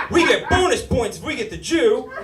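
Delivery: hammed-up eager converter; mock excited cheer. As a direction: cheer it like scoring in a game.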